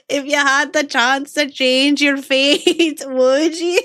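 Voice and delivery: hammed-up Scottish princess; bad Scottish accent. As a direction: put on a rough Scottish accent, laughing.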